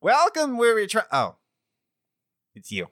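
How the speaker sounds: merchant's cheery welcome collapsing into disappointment; shopkeeper greeting then letdown. Acted. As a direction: start grandly, then deflate on seeing them. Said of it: "he says".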